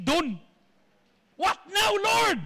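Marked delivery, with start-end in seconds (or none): 1.3-2.4 high, exasperated call to heaven; exasperated cry. Acted. Elijah asks the Lord after a burning journey, exasperated. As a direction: cry out higher in bewildered protest.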